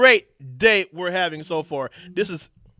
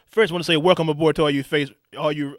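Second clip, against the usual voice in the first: ordinary talk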